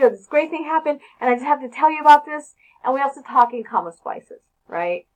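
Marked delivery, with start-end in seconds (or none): 0.1-2.5 loud, fast, higher gush of news; excited news. Retold or imitated. Teacher imitating someone bursting with news, excited and fast. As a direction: blurt it out like you can't wait to tell. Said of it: "breathless and we have some exciting news to tell somebody".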